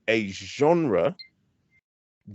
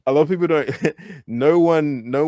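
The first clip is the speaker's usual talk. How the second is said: raised pitch, louder, breaking into laughter; amused, laughing; 5 semitones higher, 5 dB louder than usual. Real, not acted. Speaker laughing over who does reggae with which accent, amused. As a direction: laugh between the phrases as you try to get the point out. Laughs while starting a new point.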